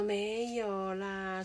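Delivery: very slow, drawn-out shy denial; mock modest denial. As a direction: stretch "没有啦" out bashfully. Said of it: "说". (拖长音害羞地推辞)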